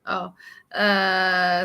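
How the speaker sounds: ordinary talk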